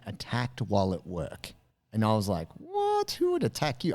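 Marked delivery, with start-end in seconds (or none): 2.6-3.9 high squeaky incredulous what; exaggerated shock at the idea; mock disbelief. Host reacting to the attack story in mock disbelief.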